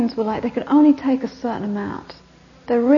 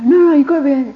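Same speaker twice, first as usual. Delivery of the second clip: insistent; 4 semitones higher, 9 dB louder, much faster than usual. Retold or imitated. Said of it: "You know".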